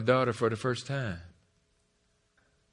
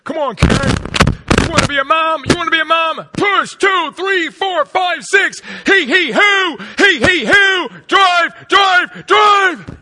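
loud, high, barking coach cheers; football coach yelling; 15 dB louder, slower than usual. Retold or imitated. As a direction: coach her through the push like a game. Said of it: "trying to help my wife, football background".

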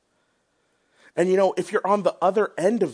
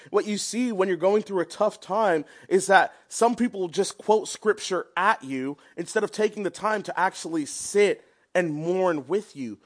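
ordinary talk

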